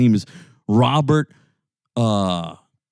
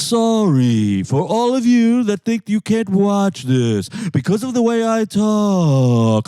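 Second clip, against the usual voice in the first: singsong YouTube lecturer; 6 semitones higher than usual. Retold or imitated. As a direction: drag out each phrase with a long falling swoop. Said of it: "And he's like".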